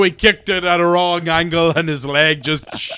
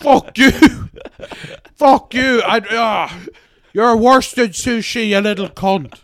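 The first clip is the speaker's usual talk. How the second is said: mock rage; 4 semitones higher, 4 dB louder than usual. Mock furious outburst.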